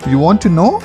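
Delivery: usual speaking voice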